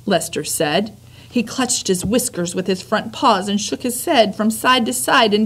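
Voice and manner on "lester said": plain reading voice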